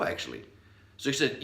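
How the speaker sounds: normal talking voice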